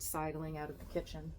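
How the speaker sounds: ordinary delivery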